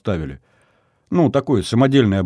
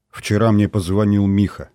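ordinary delivery

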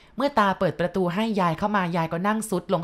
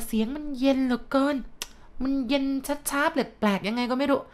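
eerie ghost voice; 8 semitones higher than usual. Retold or imitated. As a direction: draw each word out cold and strange, well above normal. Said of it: "เสียงมันเย็นเหลือเกิน มันเย็น ช้าๆ แปลกๆ".

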